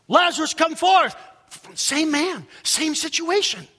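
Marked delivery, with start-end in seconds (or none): 0.1-1.1 loud, high summons; commanding call. Acted. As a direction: call the dead out with full authority. Same man now commanding as God.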